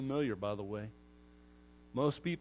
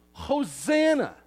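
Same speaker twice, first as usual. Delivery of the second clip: yelled hosanna; 8 semitones higher, 6 dB louder, slower than usual. Retold or imitated. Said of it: "the people yelled out".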